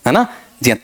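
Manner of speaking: ordinary talk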